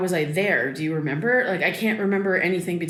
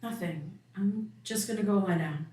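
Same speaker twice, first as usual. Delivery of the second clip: much softer, withdrawn; sullen retreat; 7 dB quieter than usual. Acted. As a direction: shut the talk down quietly. Daughter withdraws after the fight.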